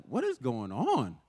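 drawn out, slow and baffled; slow puzzled wondering. Retold or imitated. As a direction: muse it slowly to yourself, puzzled. Bewildered at the mirror.

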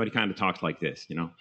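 light impression of a friend's comic-actor voice; Jerry Lewis. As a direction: talk in a lightly put-on comic voice. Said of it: "he kind of talks like Jerry Lewis".

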